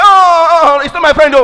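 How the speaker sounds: panicked, hurried disowning cry; alarmed denial. Retold or imitated. Pastor at the airport denying his arrested friend, alarmed.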